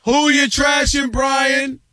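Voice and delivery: high, drawn-out radio catchphrase; sustained game-show style shout; hype call-out. Pretend. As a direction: holler it slowly up high to the caller. Ritual call-out to the caller.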